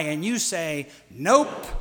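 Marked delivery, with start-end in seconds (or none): 1.1-1.7 loud, punchy congregation response; the answer in a call and response; shouted nope. Shown as a demonstration. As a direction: bark it back as one word. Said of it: "use it as a call and response".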